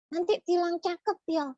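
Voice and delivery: put-on squeaky child puppet voice, confused; high puppet voice. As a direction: play the little puppet mishearing. Puppet voice of [name].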